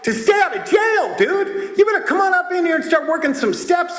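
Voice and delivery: high-pitched incredulous rant; exasperated scolding. Acted. Speaker telling a man he should have pleaded out, exasperated scolding. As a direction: berate him with rising exasperation.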